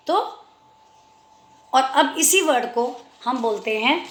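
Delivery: ordinary talk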